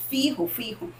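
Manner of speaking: ordinary delivery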